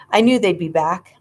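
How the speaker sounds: plain reading voice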